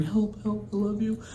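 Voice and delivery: small, high whimper, imitating a frightened child; imitated high-pitched whimpering; mock whimpering. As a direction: whimper a plea in a high little voice. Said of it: "he's whimpering".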